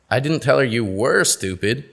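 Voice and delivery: "were" stressed, implying still stupid. Shown as a demonstration. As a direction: hit "were" past-tense. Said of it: "change the meaning of the same sentence".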